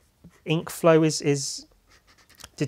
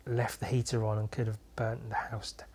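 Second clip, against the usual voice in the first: whisper; 4 semitones lower, 10 dB quieter than usual. Real, not acted. Confessing the heater incident.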